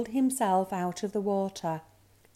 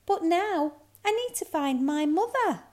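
high-pitched lost cub; little bear cub; 10 semitones higher, 3 dB louder than usual. A narrator's reading. Lost cub tells reindeer, high and needy. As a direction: plead lightly in a high little voice.

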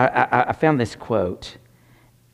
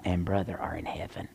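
emotional whisper, much softer; 7 semitones lower, 12 dB quieter than usual. Real, not acted. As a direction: let it fall to a breath. Emotional about his late family.

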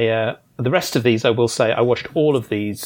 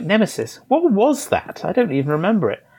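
puzzled quote; 7 semitones higher than usual. Retold or imitated. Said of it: "was like".

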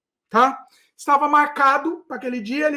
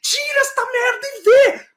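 much higher, yelling in rage; furious shout; 9 semitones higher than usual. Retold or imitated. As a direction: scream at the repairman to open it. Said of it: "com esse tom que eu tô falando". (gritando furioso com o técnico)

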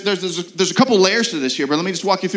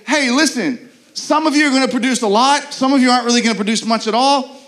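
careless dismissal; 7 semitones higher, 4 dB louder than usual. Retold or imitated. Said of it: "the most cruel thing our Savior could do is say".

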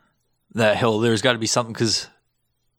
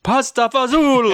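high, loud, slow hammed-up Italian accent; cheesy Italian accent, slow and loud; 14 semitones higher, 4 dB louder, slower than usual. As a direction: stretch it out in a cartoon Italian accent.

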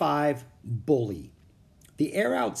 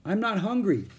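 high-pitched offer called across; raised, high friendly voice; calling out; 9 semitones higher than usual. A narrator's reading. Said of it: "he called out".

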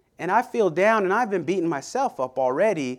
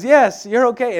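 mom reassuring; 6 semitones higher, 6 dB louder than usual. Retold or imitated. Mom reassures tiny son.

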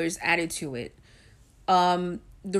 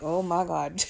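laughing; 5 dB louder than usual. Real, not acted. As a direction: laugh off mom's reaction. Laughing at mom's reaction.

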